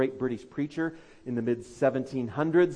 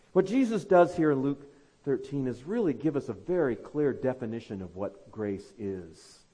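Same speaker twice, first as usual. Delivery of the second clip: ordinary delivery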